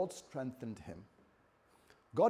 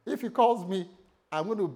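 loud, high, huffy warning; annoyed threat; 7 semitones higher, 10 dB louder than usual. Acted. Disgruntled member refusing the pastor's calls, annoyed. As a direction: threaten with mock indignation, voice raised. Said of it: "they were annoyed".

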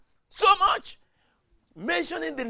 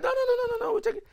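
high, laughing no no no; mock-eager pastors refusing, much higher; excited insistence; faster than usual. Pastors insisting to the preacher, high and laughing.

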